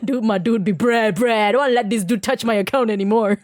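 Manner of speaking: ordinary talk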